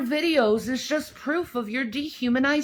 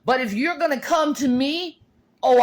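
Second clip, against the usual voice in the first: usual speaking voice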